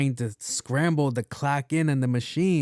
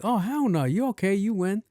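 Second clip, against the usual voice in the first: mock amazed; 8 semitones higher than usual. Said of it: "Oh, like".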